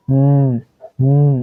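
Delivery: উম as a sustained hummed "nnn"; held N sound. Shown as a demonstration. Teacher showing how to sound N, উম as "nnn". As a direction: hum উম through an N, each separate. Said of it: "এটাকে কিভাবে sound করব?".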